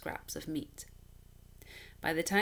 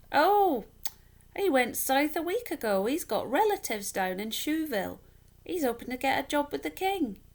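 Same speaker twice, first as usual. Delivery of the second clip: much higher and louder, bright gossip of a passerby; a townswoman rattling off news, pitched high; chatty woman; 8 semitones higher, 7 dB louder than usual. Acted. Passing woman voiced in story.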